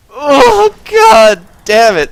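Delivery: very high, loud, slow cry; shocked outburst. Real, not acted. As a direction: yell in shocked relief. Narrow escape.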